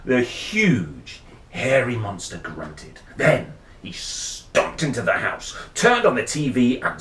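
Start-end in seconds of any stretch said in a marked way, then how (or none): none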